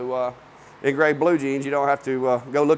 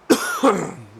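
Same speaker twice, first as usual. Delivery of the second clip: coughing on dust; 11 semitones higher, 5 dB louder than usual. Real, not acted. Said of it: "dust is getting me".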